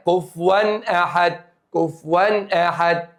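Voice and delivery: كفوا أحد recited slowly, each sound distinct; slow recitation demo. Shown as a demonstration. Teacher reciting slowly for students: كفوا أحد. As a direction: draw out كفوا أحد carefully. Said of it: "شوف بقى هنا".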